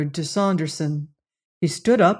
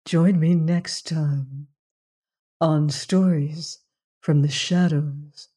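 spooky host outro. Acted. Host bids the listeners goodnight, slow and eerie. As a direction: tease the listener with a chill.

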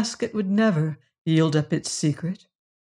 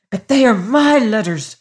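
higher and louder protest; crying out; 5 semitones higher, 7 dB louder than usual. A narrator's reading. Servant claiming the letters from Hagar, crying out louder. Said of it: "cried Peters".

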